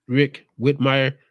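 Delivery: the name said slowly and carefully; slow down so you don't fumble the name. Shown as a demonstration. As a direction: Rick Whitmire drawn out, each syllable separate. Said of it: "I gotta say your name slow".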